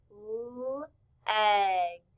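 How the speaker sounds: "l-egg" split into held l, short e, g; sounded out. Shown as a demonstration. Teacher showing class the letter sounds, sounded out. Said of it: "We hear".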